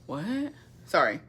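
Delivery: ordinary talk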